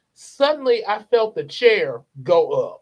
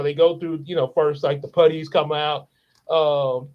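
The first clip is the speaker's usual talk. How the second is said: ordinary talk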